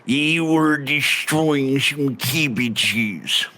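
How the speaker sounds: put-on thick rustic accent with stretched vowels; accented local. Local asks a traveller about bandits, rustic accent. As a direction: play a folksy old-country local. Voices a non-player character.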